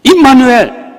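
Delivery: high, loud proclamation; shouted Immanuel. Real, not acted. Preacher proclaiming to the congregation, high loud shout. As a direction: cry it out to the hall. Calling out in sermon.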